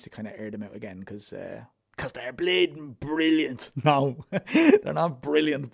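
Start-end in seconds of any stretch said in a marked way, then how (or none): 2.0-3.7 slow, higher and louder mock brag; mock boast. Host hamming up praise of his own old mixes, mock boasting. 3.8-5.7 laughing at his own boast while taking it back. Real, not acted. Host laughing off his own brag to listeners, laughing.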